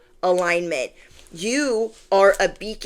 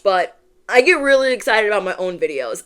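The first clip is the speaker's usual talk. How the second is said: ordinary talk, nothing marked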